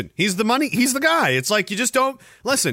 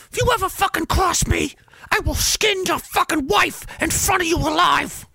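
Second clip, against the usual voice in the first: high, menacing mob boss voice; menacing Dagwood voice; 12 semitones higher than usual. Acted. Streamer voices Dagwood threatening a debtor in a high menacing voice. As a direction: play the ruthless money man, pitched up. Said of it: "it was someone else".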